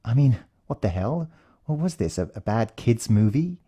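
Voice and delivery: ordinary delivery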